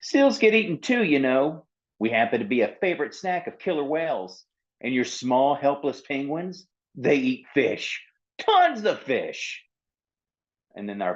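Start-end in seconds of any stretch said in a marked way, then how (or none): 0.0-9.6 high, indignant seal, slowing to make a point; defensive seal. Acted. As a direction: argue back, misunderstood.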